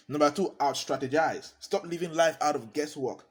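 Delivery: normal talking voice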